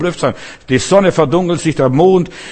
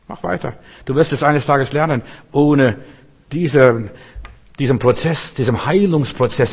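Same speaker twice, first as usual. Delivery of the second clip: ordinary delivery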